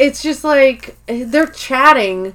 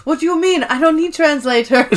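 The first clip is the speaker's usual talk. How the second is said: mock Japanese accent, raised pitch; Hide; 6 semitones higher than usual. Warehouse worker rejects coworker's offer to translate, mock Japanese accent. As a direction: imitate an indignant Japanese-accented speaker. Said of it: "And he goes".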